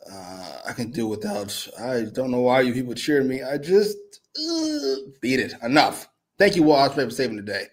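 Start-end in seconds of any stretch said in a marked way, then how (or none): none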